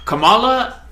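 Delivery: Kamala with stress on the middle syllable, kuh-MAH-la; wrong pronunciation. Shown as a demonstration. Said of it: "there's a wrong way to say her name".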